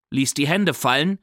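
ordinary delivery, nothing marked

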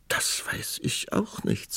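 high, soft, slow, thin voice; helpless. A narrator's reading. Queen consulting with king, helpless and soft. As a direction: sigh it out feebly.

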